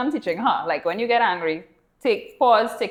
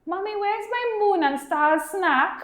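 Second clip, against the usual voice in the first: put-on high, slow little girl asking; child voice; 10 semitones higher, slower than usual. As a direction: voice a small girl asking sweetly. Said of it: "she's like".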